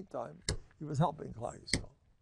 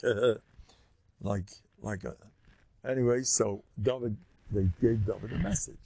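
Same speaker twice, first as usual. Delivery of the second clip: usual speaking voice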